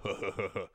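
creepy clown laugh, breathy and soft; low sinister horror-movie cackle; giggly sinister sing-song. Retold or imitated. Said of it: "Like, creepy clown laugh".

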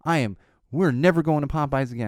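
imitated child's fury, loud and high; mad little kid. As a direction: mimic a five-year-old's indignant declaration, hitting the words hard. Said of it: "she said it just like".

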